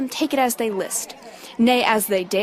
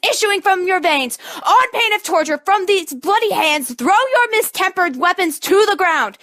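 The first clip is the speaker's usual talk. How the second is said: prince's angry rebuke; 6 semitones higher than usual. Acted. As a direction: thunder down on the rioters to make them stop.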